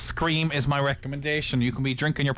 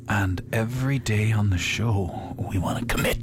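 dead voice; 6 semitones lower than usual. Pretend. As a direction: drag it out like a corpse. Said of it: "that was your dead voice".

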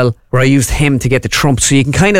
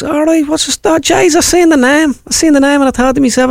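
very high-pitched North Side Dublin delivery man; comic high north Dublin voice; north side Dublin accent; 13 semitones higher than usual. Acted. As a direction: squeal it up high in broad Dublin. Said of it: "a North Side dub".

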